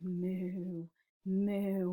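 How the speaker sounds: soft, level, hushed moo; quiet cow moo. Acted. Cow after the farmer's scolding, quiet mooing. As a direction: moo gently so nobody wakes. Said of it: "they quietly made their noises".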